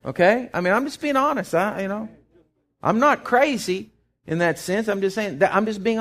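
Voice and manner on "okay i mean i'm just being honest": ordinary delivery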